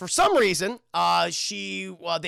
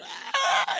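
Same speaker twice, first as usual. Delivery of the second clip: very high breathy scream; imitated high shriek. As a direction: squeal like a banished demon. Demon shrieks at exorcism.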